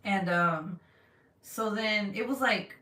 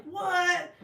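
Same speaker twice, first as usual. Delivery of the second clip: high-pitched stunned "what"; acted-out shock, pitched way up; astonished; 10 semitones higher than usual. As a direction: blurt it in drawn-out disbelief. Acting out her astonished reaction.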